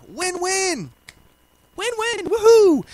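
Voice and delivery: high sing-out cheer for a win-win; high-pitched playful hooray; mock celebration. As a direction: celebrate playfully in a high voice, ending on a loud whoop. Celebrating his own easy solution.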